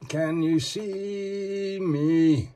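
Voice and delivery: high, drawn-out spooky voice, each word stretched; slow eerie call. Pretend. Showing off the figure, he calls out in a slow eerie voice. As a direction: call out slowly like a ghost in the dark.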